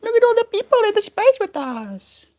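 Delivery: very high cooing voice; talking to a pet. Real, not acted. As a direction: squeak it to the bird. High voice to the bird.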